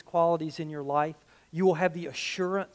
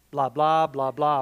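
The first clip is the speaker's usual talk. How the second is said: louder, dismissive blah blah; mock bored listener; 3 semitones lower, 5 dB louder than usual. Congregant mocking the sermon, bored and dismissive. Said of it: "Some of you are going".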